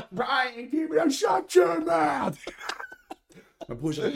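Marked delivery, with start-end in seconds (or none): 0.1-2.4 high, loud, slow mock grandma shout; grandma yelling. Grandma shouting at Brian to shut his mouth, high mock yell. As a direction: yell it like an old grandma scolding.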